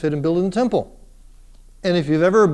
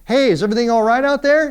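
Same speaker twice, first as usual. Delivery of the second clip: higher, calling from indoors; mom calling out; 6 semitones higher, 3 dB louder, faster than usual. Retold or imitated. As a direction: call through the window like a mom checking. We hear her say out through the screen window.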